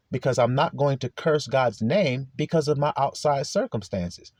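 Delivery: ordinary delivery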